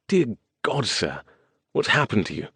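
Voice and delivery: put-on an old servant shocked at his master's state; gasping shock. A narrator's reading. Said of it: "He gasped".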